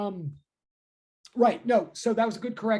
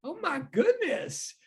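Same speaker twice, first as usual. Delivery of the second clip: real, much higher, slow surprised exclaim; voice cracks up; 6 semitones higher, slower than usual. Real, not acted. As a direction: voice jumps up in surprise. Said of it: "Why did my voice just change?".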